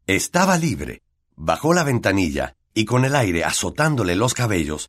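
ordinary delivery

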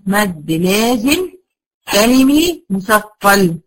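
مد لازم كلمي مثقل said very slowly, word by word; slow dictation. Shown as a demonstration. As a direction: spell out the term slowly for students to write. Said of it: "يبقى هنا اسمه إيه؟".

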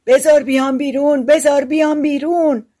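high, loud repeated plea; tiny captive creature crying out; clamouring to get out. A narrator's reading. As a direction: make a racket begging for release.

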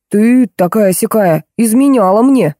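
drawn-out mock outrage; husband yelling. Joke husband accusing his wife of cheating, mock yelling. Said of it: "Муж давай орать".

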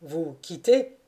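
usual speaking voice

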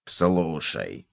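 low, soft musing; pensive cockroach. A narrator's reading. Cockroach rocking on a chair addresses gopher, pensive. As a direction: open quietly in a deep pensive voice. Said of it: "задумчиво раскачиваясь на стуле".

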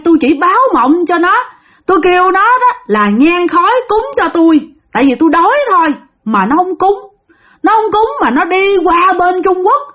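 the dead bar owner voices his grudge; angry ghost voice. Acted. Ghost explains its death to the shaman, high and bitter. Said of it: "thằng nhỏ nói ha".

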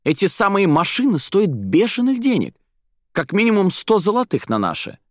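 high, agitated exclamation; overexcited near-shout; almost shouting. A narrator's reading. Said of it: "он почти кричал".

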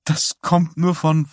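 high, tear-choked pleading; crying apology. A narrator's reading. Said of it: "und er brach in Tränen aus".

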